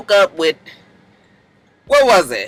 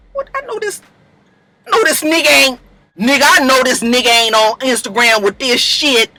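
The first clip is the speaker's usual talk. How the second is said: indignant man in an exaggerated LA accent; mock LA street accent; 3 semitones higher than usual. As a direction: put on an LA accent, incredulous.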